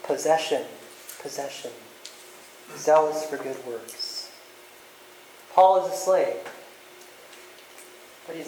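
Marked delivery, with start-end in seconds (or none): 1.2-4.3 soft echo. Shown as a demonstration. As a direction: repeat it much softer, nearly under the breath. Repeats answer as soft echo.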